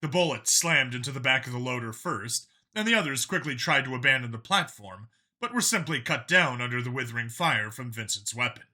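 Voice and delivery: ordinary delivery, nothing marked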